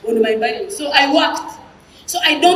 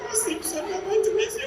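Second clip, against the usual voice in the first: high, softer fake lament; hammed-up tearful farewell; mock crying; 5 semitones higher, 5 dB quieter, faster than usual. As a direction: wail it in pretend grief. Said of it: "So I join him and we cry".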